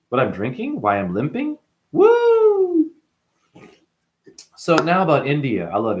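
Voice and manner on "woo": a sudden shrill high whoop; excited whoop. Real, not acted. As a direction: let out a loud celebratory woo. Excited whoop mid-rant.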